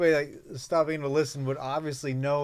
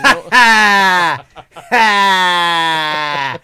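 loud laugh; 5 semitones higher, 14 dB louder, much slower than usual. Real, not acted. Big laugh at own joke.

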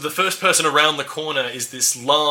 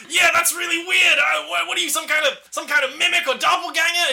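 startled shout. Acted. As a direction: shout it in shock. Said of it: "you hear another voice go".

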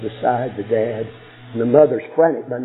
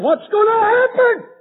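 a much higher crying wail of worry; anguished cry; 6 dB louder than usual. Acted. Preacher voicing the frightened people, wailing much higher and louder.

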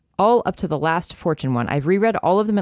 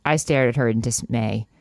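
usual speaking voice

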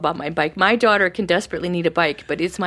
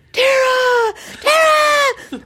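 very high, loud calling of a name; excited kid calling; 6 dB louder, much slower than usual. Retold or imitated. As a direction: call across the room like an eager boy. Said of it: "he'd come pelting across the floor".

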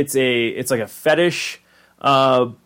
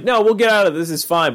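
raised-pitch reassurance; Rick; 7 semitones higher than usual. Retold or imitated. Rick trying to reassure Morty, lightly imitated in a higher voice. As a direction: imitate hurried soothing of a worried kid. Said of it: "Rick is trying to reassure Morty".

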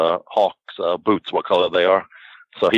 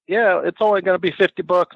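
a light higher mimic of a working girl; higher voiced girl naming her price; 10 semitones higher than usual. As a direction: pitch it up, casually imitating the girl. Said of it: "So then they go".

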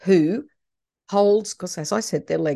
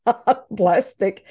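usual speaking voice

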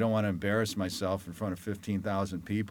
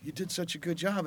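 excited reporters; 8 semitones higher than usual. Retold or imitated. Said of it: "and they go".

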